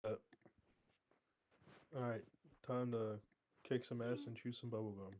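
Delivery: normal talking voice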